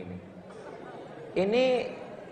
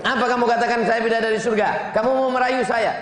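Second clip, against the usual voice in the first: higher, louder challenging wife; suspicious wife; 5 semitones higher, 6 dB louder, faster than usual. Acted. Wife challenging husband's flattery, loud and high. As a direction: demand it sharply.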